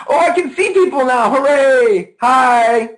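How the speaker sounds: high, loud, excited cheer; light up as friends come into view; real joyful outburst, breaking into a laugh; delighted greeting. Real, not acted. Delighted to see the audience appear.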